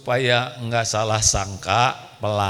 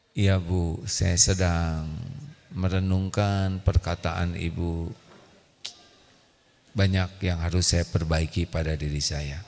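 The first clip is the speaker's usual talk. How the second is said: softer, lower humble reply; meek calm husband; 7 semitones lower, 7 dB quieter than usual. Retold or imitated. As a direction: soothe the wife gently.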